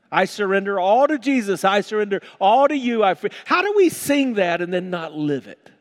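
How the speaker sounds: loud, high pulpit delivery; full-force preaching. Real, not acted.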